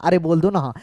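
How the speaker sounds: ordinary talk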